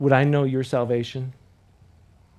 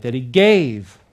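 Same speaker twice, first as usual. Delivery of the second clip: louder, punchy "That he gave"; with an exclamation point; 2 semitones lower, 7 dB louder, slower than usual. Shown as a demonstration. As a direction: hit it like it ends in an exclamation mark. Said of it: "One more time with an exclamation point".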